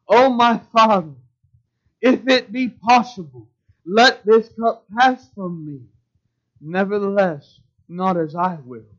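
loud, pleading cry to the Father; anguished loud prayer. Acted. Preacher voicing Jesus praying to his Father, loud anguished plea. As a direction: pour out the prayer at full force. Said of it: "Do you hear the emotional state of Jesus?".

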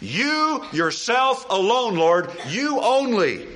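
slow, weighty emphasis on "You yourself alone"; very emphatic. Acted. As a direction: stress YOU, yourself, alone and only, each word drawn out. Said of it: "very emphatic".

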